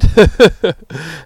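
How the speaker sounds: big burst of high laughter; real laughter; loud laugh. Real, not acted. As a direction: laugh out at the absurd story. Laughing at the old head story.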